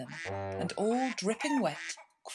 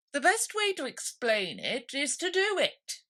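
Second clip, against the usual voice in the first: dodo explaining; 8 semitones higher than usual. A narrator's reading.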